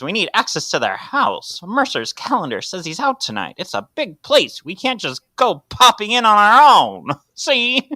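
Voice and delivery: building, high noir gangster; noir detective. Acted. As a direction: push the tough-guy voice up. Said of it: "sounds just like the Noir voice".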